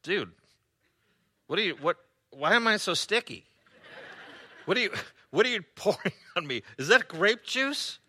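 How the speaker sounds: hammed-up puzzled groan, voice climbing; wounded man confused. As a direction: play the sticky confused traveller. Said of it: "laying there going".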